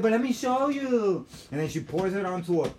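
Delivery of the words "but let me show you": high, loud mimicry of her; pleading insistence. Woman wanting to demonstrate, high loud pleading. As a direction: insist on showing, pitched way up.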